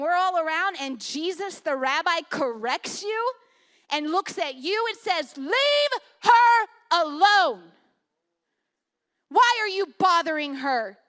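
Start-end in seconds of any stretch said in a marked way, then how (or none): 5.4-11.0 loud, drawn-out scolding command; acted rabbi's raised-voice correction; stern rebuke. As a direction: rebuke them forcefully, slowly hitting each word. Acting out Jesus correcting Judas.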